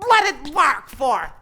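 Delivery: loud, high burst of glee; loud gleeful cry; excited outburst. Acted. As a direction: crow it out triumphantly. Hamlet exults over the plot.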